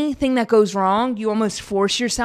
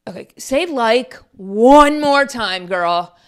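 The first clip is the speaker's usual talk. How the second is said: mock self-scolding; 2 semitones higher, 5 dB louder, much slower than usual. Real, not acted. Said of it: "Like, I'm like".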